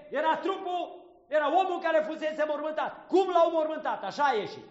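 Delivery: high, loud exclaiming to the congregation; fervent preaching shout. Real, not acted. Priest marvelling at Lazarus to his flock, shouting. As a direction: burst out in amazement, full voice.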